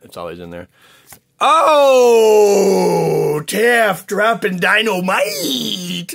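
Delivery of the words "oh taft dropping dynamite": much higher, much louder, slow, cracking squeal; voice cracking with excitement. Real, not acted. Reacting to a friend's big pull, with a real cracking voice. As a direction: voice breaks up high over a big pull. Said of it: "my voice is still cracking".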